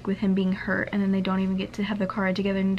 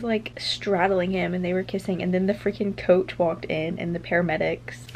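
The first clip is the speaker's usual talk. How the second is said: normal talking voice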